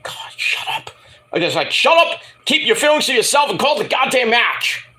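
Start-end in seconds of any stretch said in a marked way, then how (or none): 0.0-0.9 whispered, weary plea; exasperated aside. Retold or imitated. Muttered reaction to commentators. 1.7-4.9 high-pitched fed-up rant; exasperated outburst. Retold or imitated. Rants back at commentators.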